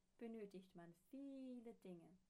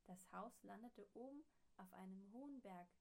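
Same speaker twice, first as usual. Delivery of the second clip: ordinary delivery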